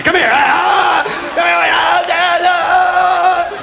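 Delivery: high yelps "ah, ah" as the tongue is yanked; angry dad and yelping kid. Retold or imitated. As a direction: act out the kid dragged by his tongue stud. Dad grabbing tongue stud.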